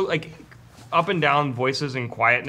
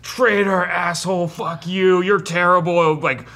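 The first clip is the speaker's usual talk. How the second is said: loud, high jeers hurled from the cells; screaming orc mob; 6 semitones higher, 8 dB louder, slower than usual. Acted. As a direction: shout abuse like a heckling prisoner. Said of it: "are just screaming".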